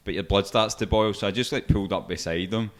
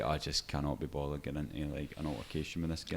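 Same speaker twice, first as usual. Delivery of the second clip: weary inner voice; 6 semitones lower, 11 dB quieter than usual. Retold or imitated. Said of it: "I'm just like".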